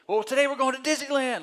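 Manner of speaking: high, loud, showman reveal; spring the big surprise on the kids; announcing dad. Retold or imitated.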